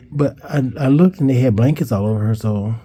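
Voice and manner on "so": usual speaking voice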